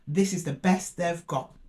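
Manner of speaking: ordinary talk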